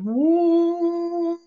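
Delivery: high, drawn-out mimicked moo; imitated moo call. Telling a friend about the creature's distant high moo. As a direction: copy the far-off call up high and long. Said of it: "I just hear the noise going".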